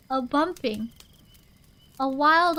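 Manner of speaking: plain reading voice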